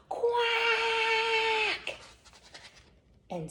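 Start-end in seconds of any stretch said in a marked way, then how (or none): 0.1-2.0 high sustained furious quack; angry quack. Acted. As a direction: duck finally snapping, quacking. Duck snaps.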